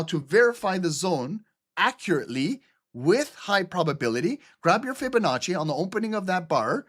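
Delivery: ordinary delivery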